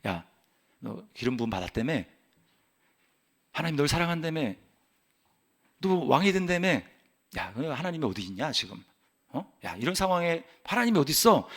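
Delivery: low, soft imitation of taunters; mock him under the voice, doubting his God. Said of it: "조롱하는 말이 들리는 거예요". (낮은 소리로 비아냥대는 흉내)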